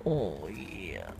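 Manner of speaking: low, soft reassurance; calm the animal down gently; soft low murmur to a nervous pet; soothing. Real, not acted.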